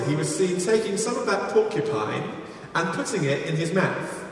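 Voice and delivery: ordinary talk, nothing marked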